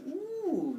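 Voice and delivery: put-on high "ooh" of childlike wonder; awed ooh. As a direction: coo high, as if spotting treasure. Child amazed by glowing cows.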